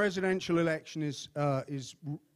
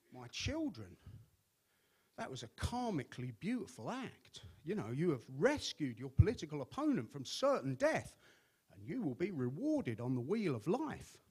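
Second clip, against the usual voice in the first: put-on soft, quiet Tibetan-tinged accent of the Dalai Lama; gentle holy-man accent, spoken softly; 6 dB quieter than usual. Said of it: "without that great accent".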